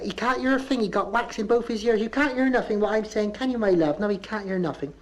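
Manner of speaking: high rising Bristol mother's voice. Retold or imitated. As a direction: lift every line up like a question. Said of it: "She said".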